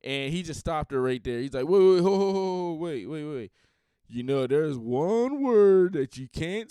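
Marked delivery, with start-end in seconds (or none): 1.7-3.5 sudden loud, higher "wait, ho" cutting someone off; mock halt. [name] stopping the fan on stage, a loud higher mock cut-off. 4.1-6.7 slow, louder, lecturing scold; mock scolding. [name] lecturing the girl on stage, a slow, louder mock scold.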